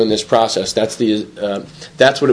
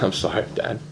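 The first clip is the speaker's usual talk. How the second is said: low, very soft apology; hushed and regretful; subdued apology; 5 semitones lower, 11 dB quieter than usual. Retold or imitated. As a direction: say it quietly, as a heartfelt apology to a father. Retelling his apology to his father.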